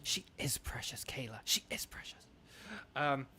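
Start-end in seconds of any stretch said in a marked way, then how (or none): 0.0-2.2 soft whispered aside; hushed adoring whisper. Real, not acted. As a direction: breathe it to chat, unvoiced. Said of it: "whispered aside to chat".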